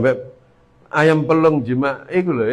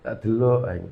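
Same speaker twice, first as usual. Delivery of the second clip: ordinary talk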